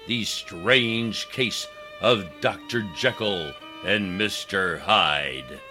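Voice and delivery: usual speaking voice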